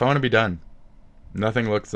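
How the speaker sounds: ordinary talk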